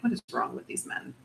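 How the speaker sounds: low, quiet exasperated grumble; imitating a woman wearily done with it all; annoyed. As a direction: grumble it under your breath, fed up. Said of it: "she is so annoyed".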